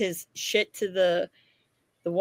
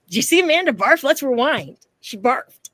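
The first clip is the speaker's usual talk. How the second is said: mock gossip; 5 semitones higher, 6 dB louder than usual. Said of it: "everyone's gonna say".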